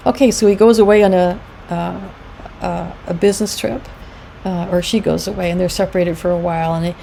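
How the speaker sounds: ordinary talk, nothing marked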